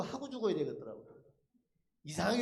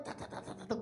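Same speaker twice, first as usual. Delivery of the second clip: rapid stuttered teeth-chatter imitation; 10 dB quieter than usual. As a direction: rattle the syllables like chattering teeth. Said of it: "이빨이". (이빨이 딱딱 부딪치는 소리 흉내)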